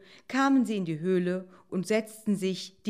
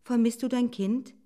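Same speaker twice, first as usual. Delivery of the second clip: higher, hasty fox question; said quickly; 7 semitones higher, 5 dB louder than usual. A narrator's reading. Fox stopping a fleeing doe, mildly hurried. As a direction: blurt it before she runs. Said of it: "sagte schnell".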